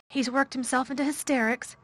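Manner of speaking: hurried, high and anxious. A narrator's reading. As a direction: rush it out in alarm. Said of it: "she said in a great hurry".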